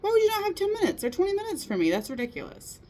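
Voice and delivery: high, fast indignant huff that drops to a flat mutter at the end; mock upset outburst. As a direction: act out being brushed off, half-annoyed. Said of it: "And I'm upset. I'm mad".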